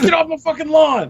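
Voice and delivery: put-on high, cranky old-man snarl; hammed-up grumpy old man. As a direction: shoo kids off the lawn. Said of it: "salty like an old man".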